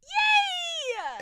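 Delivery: high excited yay; excited squeal. Real, not acted. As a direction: squeal with delight. Excited cheer about dinner plans.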